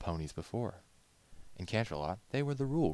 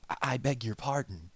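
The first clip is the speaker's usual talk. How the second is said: put-on stammering, flustered young stallion; hesitant stammer; 7 semitones higher, 5 dB louder than usual. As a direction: trip over the first word, caught off guard. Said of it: "he said after a pause".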